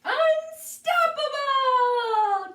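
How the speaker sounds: long, high, loud, stretched out yell; battle cry. Acted. The new creature taunting the cat, long loud high victory cry. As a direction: bellow it like a victory roar.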